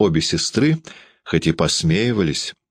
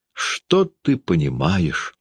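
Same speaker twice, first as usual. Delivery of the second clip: usual speaking voice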